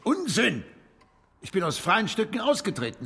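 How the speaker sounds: Unsinn deutlich höher herausgestoßen; gekränkt abwehren; entrüstet. Acted. Weist Vorwurf des Rauswurfs zurück. (empörte Zurückweisung)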